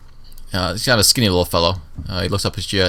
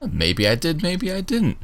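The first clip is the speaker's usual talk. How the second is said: coy, rising on the last words; teasing guard; 10 semitones higher, slower than usual. Acted. Guard teasing Johnson once more, coy. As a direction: play coy with him.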